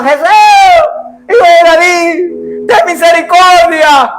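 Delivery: clamor alto y lento, desesperado; gritos de súplica. Acted. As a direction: grita suplicando como un ciego. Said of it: "Los ciegos pegaban gritos". (grito desesperado de súplica)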